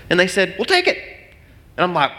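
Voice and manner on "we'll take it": high, eager offer; eager buyers. Retold or imitated. Buyers telling pastor they want the house, high and eager. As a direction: snap it up eagerly.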